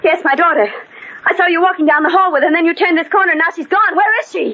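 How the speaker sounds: high, fast, rising panic; frantic. Acted. Mother demanding her daughter from the nurse, high and frantic. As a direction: rush it out, desperate.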